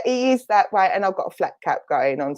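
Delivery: ordinary talk, nothing marked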